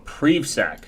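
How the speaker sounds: PrivSec drawn out as "preev-sack"; long-e pronunciation. Shown as a demonstration. Streamer realising another pronunciation, PrivSec as slow "preev-sack". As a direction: slowly say PrivSec with an "ee" vowel. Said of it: "doesn't sound right".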